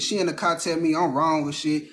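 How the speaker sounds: ordinary delivery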